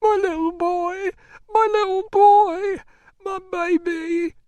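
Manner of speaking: high, slow keening; grieving moan. A narrator's reading. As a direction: moan it out, stretching each word. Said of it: "she moaned".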